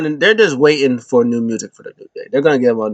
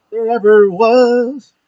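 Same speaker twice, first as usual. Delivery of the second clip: slow higher drawn-out boast; mock boast; 9 semitones higher, 4 dB louder, much slower than usual. Champion boasting on the microphone, slow drawn-out brag. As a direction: stretch the brag out slowly. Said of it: "she's like".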